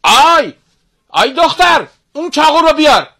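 put-on high, loud, strained shout of a cruel old sorcerer; sorcerer father yelling. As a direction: bark the order at the girl like a wicked master.

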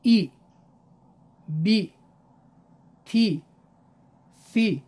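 each letter with a short kasra: ای، بی، تی، فی; clipped demo syllables ای بی تی فی; kasra letter drill. Shown as a demonstration. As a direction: say ای، بی، تی، فی each short and crisp, one beat each. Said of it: "الفبا را با کسره ملاحظه بفرمایید".

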